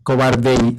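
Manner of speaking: "Cobardei" with the final e and i merged into one syllable; vowels joined. Shown as a demonstration. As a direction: run "Cobardei" together as one gliding sound. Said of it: "se junta la E con la I y dice cobardei".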